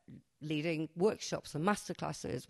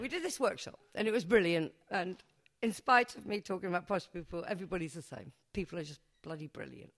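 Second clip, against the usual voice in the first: ordinary talk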